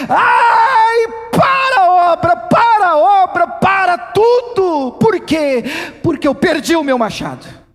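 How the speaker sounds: loud, high outcry, pleading for help; desperate shouting plea. Acted. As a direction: shout it out as if the work has stopped. Preacher acts out a desperate plea.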